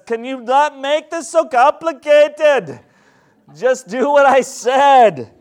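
high, loud, slow put-on accent; God in put-on accent. God tells believers to stop complicating it, slow put-on accent. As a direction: draw it out loudly in a hammed-up accent. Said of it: "I don't know why he took that accent".

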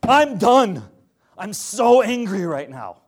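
loud, high exasperated outburst; mock angry God. Preacher voicing God's anger at the golden calf, loud and exasperated. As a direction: shout it like you've had enough. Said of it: "God was like".

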